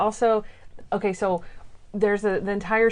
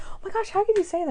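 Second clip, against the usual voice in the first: high, rushed imitated dismay; mock shock; 12 semitones higher, faster than usual. Co-host plays the woman accused of poisoning Monk, shocked. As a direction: protest quickly in a high pitch. Offended dinner date answers Monk's accusation.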